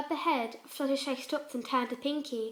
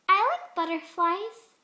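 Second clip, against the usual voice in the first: higher, louder, slow; forcing cheer; lightly nervous pony; uncertain Pinkie; 7 semitones higher, 8 dB louder, slower than usual. Acted. Pinkie voiced in story.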